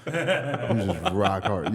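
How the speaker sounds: very slow, laughing. Real, not acted. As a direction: giggle through the line. Laughing at the story.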